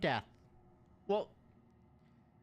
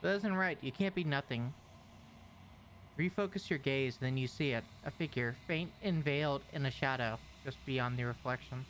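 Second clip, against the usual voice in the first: game narration; 9 semitones lower, 3 dB quieter than usual. Acted. Reads game narration aloud.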